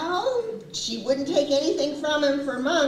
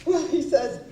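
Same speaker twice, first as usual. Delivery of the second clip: normal talking voice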